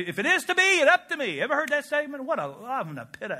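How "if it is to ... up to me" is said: self-reliant slogan. Acted. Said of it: "We just say".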